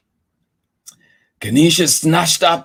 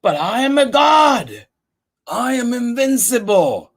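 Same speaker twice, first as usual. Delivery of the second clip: boastful god; 9 semitones higher, 3 dB louder than usual. Acted. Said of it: "But Ganesha said".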